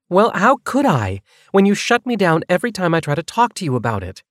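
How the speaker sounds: high-pitched indignant retort; frustrated, insistent challenge; Nevaeh, exasperated. Acted.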